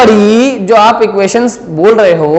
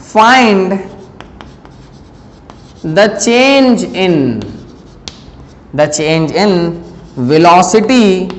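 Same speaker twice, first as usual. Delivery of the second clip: very slow, word by word with long pauses; measured classroom dictation in short chunks; 2 semitones lower, much slower than usual. Acted. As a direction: dictate a question for students to write down. Dictates the question to students.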